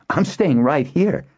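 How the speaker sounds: high, stubborn declaration; indignant, determined protest; defiant cry. A narrator's reading. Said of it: "Nuella cried".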